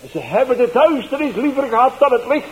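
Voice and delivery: high, loud shouted repeat of the verse; mocking loud shout of the learned text; heckler shouting the verse. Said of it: "En hij riep".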